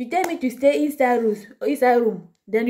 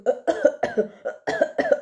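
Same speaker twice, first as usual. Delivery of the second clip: fake cough; 4 semitones higher than usual.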